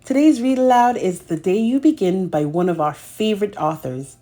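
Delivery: ordinary delivery, nothing marked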